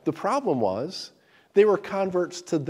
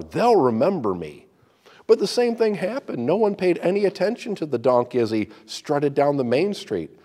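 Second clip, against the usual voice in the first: lively, louder storytelling read; story narration; 6 dB louder than usual. Acted. Preacher reading a donkey fable aloud, dramatic.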